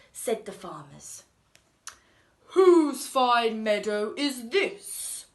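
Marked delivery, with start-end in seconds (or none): 2.5-5.3 loud, slow, regal king voice; grand king. Acted. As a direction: boom it out like a king.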